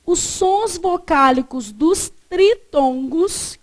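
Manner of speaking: slow, measured words, each spaced out; slow dictation. Shown as a demonstration. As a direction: dictate it deliberately, word by word. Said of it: "você colocou pra mim assim".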